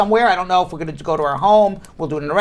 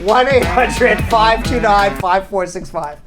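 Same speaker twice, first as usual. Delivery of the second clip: slow, deliberate number read; slow announcer read; slower than usual. Acted. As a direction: spell the phone number slowly for listeners. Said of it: "reading the number over music".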